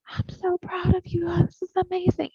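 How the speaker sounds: a touch of high gushing praise; lightly voiced delighted parent; proud praise. Someone who never praised you, mildly imitated gushing. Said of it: "telling you".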